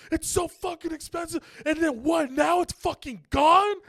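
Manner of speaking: a teacher shrieking in rage; furious screaming rant. Retold or imitated. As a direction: scream it at the parent, full fury. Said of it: "Miss Diaz comes out and goes".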